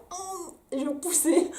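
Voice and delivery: high, slow strained push; mock straining. Mother acting herself pushing anyway, mock strain. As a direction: squeeze it out as if pushing hard.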